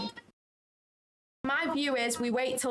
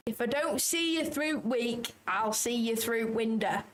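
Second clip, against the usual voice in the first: put-on slow saying in a Northern English accent; broad Northern English, drawn out; granddad's Northern English dialect. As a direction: imitate granddad's Northern English, taking it slowly. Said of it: "this is what my granddad used to say".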